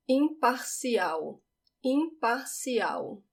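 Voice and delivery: Imparcial slow, final L not rolled, like a W; unrolled final L. Shown as a demonstration. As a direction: Imparcial ending in w. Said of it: "Notice that I do not roll it".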